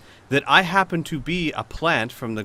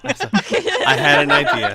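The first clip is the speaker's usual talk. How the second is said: louder, laughing through it; 3 semitones higher, 8 dB louder, slower than usual. Real, not acted. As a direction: say it again, cracking up. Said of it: "God wants the story to move along".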